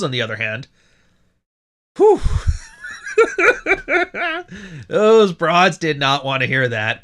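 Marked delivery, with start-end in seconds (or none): 2.2-4.8 high laugh. Real, not acted. Laughing at outrage.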